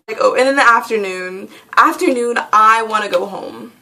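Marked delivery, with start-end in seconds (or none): none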